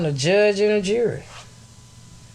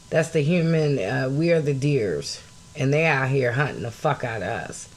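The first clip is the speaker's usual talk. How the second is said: usual speaking voice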